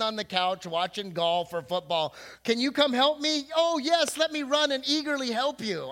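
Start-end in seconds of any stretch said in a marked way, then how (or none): 2.4-3.5 higher, louder call for help from the kitchen; calling wife. Retold or imitated. Wife calling husband off the couch, higher and louder call. 3.5-5.8 higher, louder mock-eager reply; sarcastic eagerness. Husband on the couch answering his wife, higher mock-eager sarcasm.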